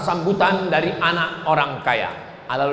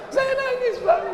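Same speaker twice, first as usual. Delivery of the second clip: much higher, put-on whiny widow voice; mock weeping widow; 3 dB quieter than usual. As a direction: ham up a tearful widow. Widow answers, mock crying.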